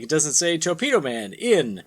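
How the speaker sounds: ordinary talk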